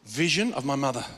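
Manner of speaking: ordinary delivery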